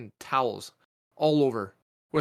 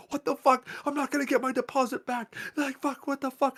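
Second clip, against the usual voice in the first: much higher, pitched-up exasperated outburst; retold angry rant. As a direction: rant at Carly about the deposit. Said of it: "So I'm mad at Carly".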